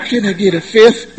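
laughing "Can I get a fifth?" in a light imitation. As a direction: repeat the request, amused. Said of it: "Sounded like [name] on a bad day".

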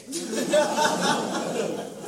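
high breathy burst of laughter; rueful high laugh. Real, not acted. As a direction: laugh at your own screwup. Laughs at failing first day.

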